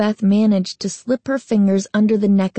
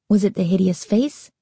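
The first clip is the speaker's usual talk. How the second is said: plain reading voice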